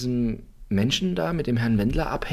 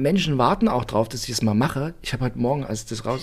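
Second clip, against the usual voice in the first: usual speaking voice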